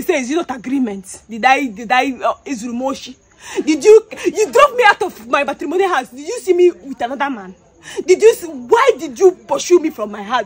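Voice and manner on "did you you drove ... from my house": loud, raised, hurt questioning; real wounded outburst; anguished accusation. Real, not acted. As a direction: confront an absent husband in distress. Accusing her husband while distraught.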